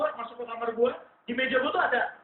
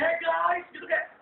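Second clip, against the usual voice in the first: cockroach friends; 4 semitones higher than usual. Acted. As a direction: tease the perfumed one as a jeering roach. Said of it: "dia main kayak".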